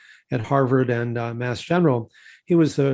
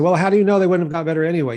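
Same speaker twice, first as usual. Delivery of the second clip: fast, higher, louder mock doubter; skeptical critics; 5 semitones higher, 6 dB louder, faster than usual. Critics challenging the student's study, fast, higher and louder. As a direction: rattle off the objection high and loud.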